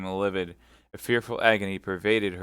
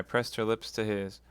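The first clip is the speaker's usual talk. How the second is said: plain reading voice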